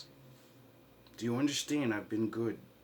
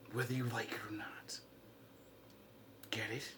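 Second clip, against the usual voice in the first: whisper; 12 dB quieter, faster than usual. Real, not acted. Ominous warning in rant.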